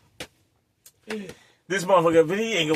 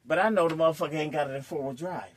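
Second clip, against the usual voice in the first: ordinary talk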